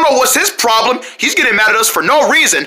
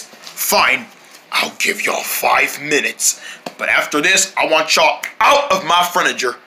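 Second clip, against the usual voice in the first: grudging; 6 semitones lower, 3 dB quieter than usual. Acted. Angry host relents grudgingly.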